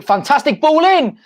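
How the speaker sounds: rising, drawn-out shout of praise; excited cheer. Real, not acted. Fan praising a cross during live match, excited.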